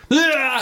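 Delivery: high imitated metal-fan cry; excited mock yell. Metal fans so excited they hit themselves, mock yell. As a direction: let out a high, hyped-up "ah". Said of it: "they get so excited".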